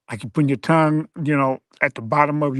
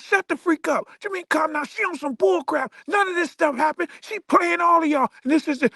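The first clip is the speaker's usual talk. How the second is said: much higher ranting man, yelling it off; flipping out; 12 semitones higher than usual. Retold or imitated. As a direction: rant like he's lost it in public. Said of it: "He like".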